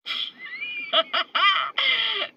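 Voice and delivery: put-on high, loud girl's giggle; high child's giggle. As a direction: giggle like a little girl. Child's laugh in the memory.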